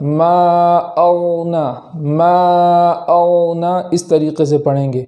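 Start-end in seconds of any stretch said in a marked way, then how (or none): 0.0-3.8 slow, drawn-out recitation with a full heavy غ in ما اونا; heavy Quranic ghain, slow. Shown as a demonstration. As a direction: stretch ما اونا slowly, the غ thick and full. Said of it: "موٹی آواز کے ساتھ".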